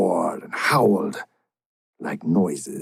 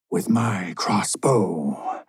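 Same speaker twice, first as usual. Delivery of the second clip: slow, hushed confession; a slow whisper of a terrible admission; guilty whisper; slower than usual. Acted. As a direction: breathe it out as a dreadful secret. Mariner confesses his crime.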